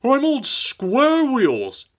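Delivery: slow, high indignant complaint; fuming. A narrator's reading. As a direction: seethe with hurt pride. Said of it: "fumed Henry".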